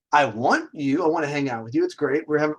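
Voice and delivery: ordinary talk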